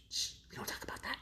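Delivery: hushed secret whisper, fast. Pretend. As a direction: shush it like a secret. Hushing a secret.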